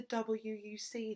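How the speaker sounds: ordinary delivery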